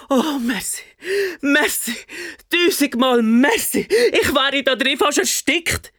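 put-on high, relieved child voice; grateful boy. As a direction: gush thanks like a rescued kid. Rescued boy thanks monster.

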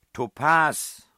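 loud, high shout from the next room; called out. A narrator's reading. Man calling the puzzle answer from his room, loud shout. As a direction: call the answer out so it carries through the door. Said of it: "rief ich aus meinem Zimmer".

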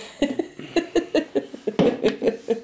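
a burst of high, airy laughter; high breathy laugh. Real, not acted. Host laughing at her own beer joke, high airy laugh.

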